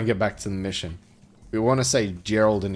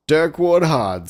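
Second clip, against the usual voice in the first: lightly voiced president, higher and slow; grand, drawn-out naming of the hero; a hint of a ceremonial address; 7 semitones higher, 7 dB louder, much slower than usual. A narrator's reading.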